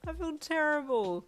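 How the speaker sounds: loud, high, miserable whine; sickly, pitiful complaint; pitiful wail. A narrator's reading. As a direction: wail it, sorry for yourself. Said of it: "you wailed pitifully".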